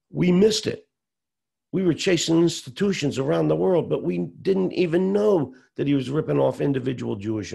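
furious outburst. Retold or imitated. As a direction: let the rage spill out at the partners. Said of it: "I had fist of rage".

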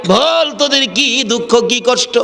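high, slow imploring question; impassioned plea. Acted. Prophet asks the children about their sorrow, high imploring tone.

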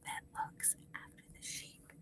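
barely audible whisper; whispered echo. Shown as a demonstration. Teacher leaves room for class to repeat, whispering faintly.

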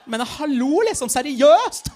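put-on disgusted teen girl. Teen girl on the bus finding Rashid on the list, shrieking in disgust. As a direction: squeal in teenage revulsion.

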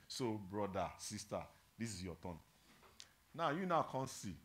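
ordinary delivery

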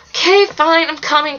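restrained shout; yelled. A narrator's reading. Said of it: "Connor yelled".

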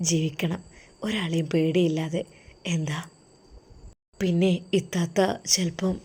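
husband quietly comforting his wife before going abroad; tender husband. Acted. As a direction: soothe her gently before he leaves.

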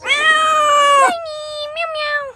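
very high cat-calling voice. Real, not acted. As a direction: coo at the kittens. Calling the cats.

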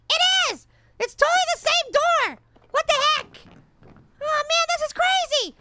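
high-pitched, incredulous outburst; astonished realisation. Real, not acted. Player realises it's the same door again, astonished outburst. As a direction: exclaim it in real disbelief.

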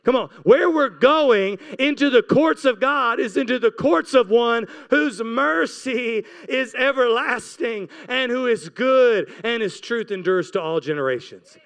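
usual speaking voice

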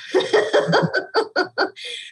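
airy giggle; real laugh high and breathy; breathless high laugh. Real, not acted. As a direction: laugh it off, embarrassed. Laughing at own story.

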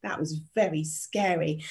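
usual speaking voice

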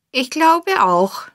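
slow, high, drawn-out sneer; höhnisch. A narrator's reading. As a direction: sneer it slowly with mock agreement. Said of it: "sagte Herr Feng höhnisch lächelnd". (höhnisch, gedehnt und hoch)

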